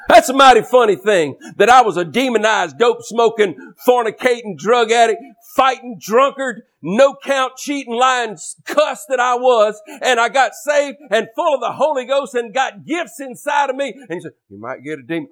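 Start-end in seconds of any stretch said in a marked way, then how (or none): 0.1-13.9 high, forceful pulpit testimony; impassioned preaching. Real, not acted. 14.5-15.3 mock the warning under your breath; mocked timid warning. Mimics the critic's warning.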